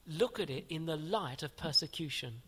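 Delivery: ordinary delivery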